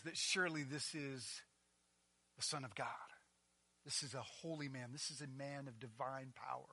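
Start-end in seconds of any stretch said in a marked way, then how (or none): none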